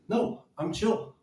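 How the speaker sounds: mock gasp then calm. Shown as a demonstration. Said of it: "do you see me breathing heavy".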